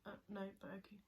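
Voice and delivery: unvoiced reluctant reply; whispered. Real, not acted. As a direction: whisper your hesitant yes.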